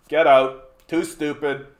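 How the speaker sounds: normal talking voice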